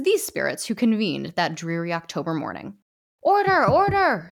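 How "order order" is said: loud, slow, high judge's call; calling to order. Acted. Ghostly judge silencing the crowd, booming. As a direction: bellow for order over the room.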